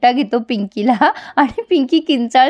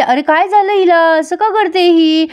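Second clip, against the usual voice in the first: higher, fussy sister-in-law voice; Chhabi's voice; 7 semitones higher than usual. Acted. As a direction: pitch up into a nosy sister-in-law.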